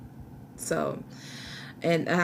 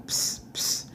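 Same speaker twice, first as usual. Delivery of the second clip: imitated hiss psst; 4 dB quieter than usual. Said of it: "talking about".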